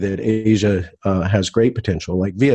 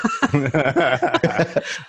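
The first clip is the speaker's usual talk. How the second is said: laughing; 6 semitones higher than usual. Real, not acted. Asked to do impression on air.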